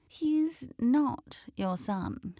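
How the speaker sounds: put-on slow, disdainful old hen; sniffy old hen. A narrator's reading. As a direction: sniff it out slowly and scornfully.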